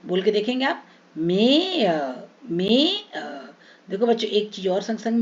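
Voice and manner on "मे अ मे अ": मे sliding slowly into अ; gliding vowel. Shown as a demonstration. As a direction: glide from मे to अ, slowly. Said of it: "एक जगह से दूसरी जगह पे हम खिसक के जा रहे हैं". (मे से अ तक धीरे खिसकना)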